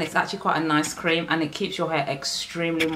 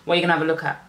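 usual speaking voice

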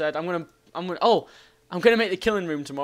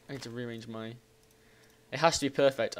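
ordinary talk, nothing marked

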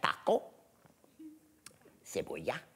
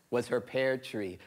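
plain reading voice

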